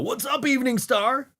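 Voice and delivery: high, bright crowd greeting; cheery mock frontman; stage shout-out. Shown as a demonstration. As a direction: greet the venue by name. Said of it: "That would've been fine".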